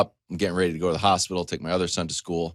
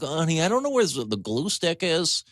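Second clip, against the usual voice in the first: high, whiny helpless voice; helpless plea; 9 semitones higher than usual. Retold or imitated. Husband to sleeping wife, helpless whine. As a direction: plead like a lost husband.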